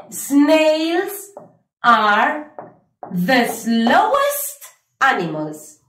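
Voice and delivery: slow, careful English model sentence; teacher modelling the answer for students. Shown as a demonstration. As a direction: read it out slowly for the class. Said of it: "vamos a decir".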